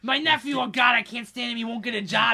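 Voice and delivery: put-on loud, brash New York accent. New York accent, ranting about his nephew on the way to the gathering. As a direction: bark it out like a New Yorker stuck in traffic. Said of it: "classic New Yorker".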